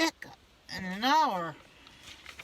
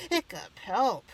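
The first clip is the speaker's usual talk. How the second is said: mock yell; 3 semitones higher, 7 dB louder, slower than usual. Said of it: "he yelled".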